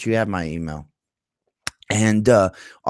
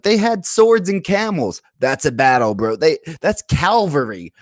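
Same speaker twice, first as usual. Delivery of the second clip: mocking raiders; 7 semitones higher than usual. To the chat, mocking raiders boasting, in a high bragging voice. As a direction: ham up the boastful raider bro.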